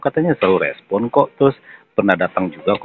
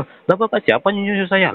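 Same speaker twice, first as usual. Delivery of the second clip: retold angry retort; 10 semitones higher, slower than usual. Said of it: "saya langsung emosi dong dengernya".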